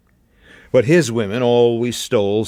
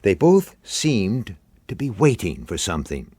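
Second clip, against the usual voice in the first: plain reading voice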